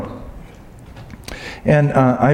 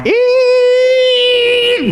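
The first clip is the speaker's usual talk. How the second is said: high sung squeal of alarm; mock squeal. Believer told to go talk, squealing in mock alarm. Reacting to God's call to speak.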